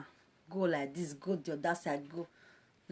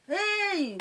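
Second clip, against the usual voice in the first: high hey; 11 semitones higher than usual. Real, not acted. Exclaiming in surprise.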